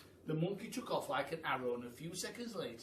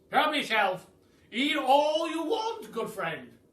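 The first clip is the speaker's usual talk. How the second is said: loud, grand aristocratic Duke, higher; booming Duke; 11 semitones higher, 12 dB louder, slower than usual. Acted. The Duke welcomes the hungry giraffe, booming and grand. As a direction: boom it out like a jolly grand duke.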